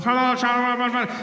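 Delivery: muffled, barely intelligible cop orders; irritated police loudspeaker. Retold or imitated. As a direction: run the words together like a cop on a bad speaker. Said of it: "I don't know what the hell you want".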